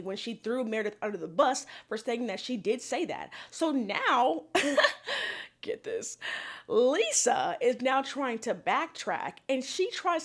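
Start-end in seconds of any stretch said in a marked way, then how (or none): 4.5-5.6 short high laugh; amused laugh. Real, not acted. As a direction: burst out laughing. Laughs at Lisa backtracking.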